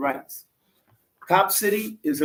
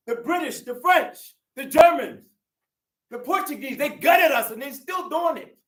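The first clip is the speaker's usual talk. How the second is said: angry shout; 7 semitones higher than usual. Real, not acted. Rally speaker denouncing colonizers.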